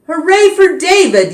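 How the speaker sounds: high, loud hooray; cheering yell. A narrator's reading. Class cheering David for catching gerbils, high loud hooray. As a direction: cheer him as a hero. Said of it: "yelled the class".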